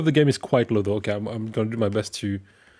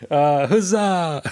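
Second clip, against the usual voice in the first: excited; 7 semitones higher, 6 dB louder, much slower than usual. Real, not acted. Cheering fixed audio.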